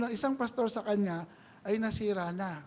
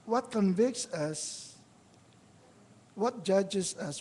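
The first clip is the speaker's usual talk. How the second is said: slow searching questions; much slower than usual. Acted. Preacher's pointed rhetorical questions.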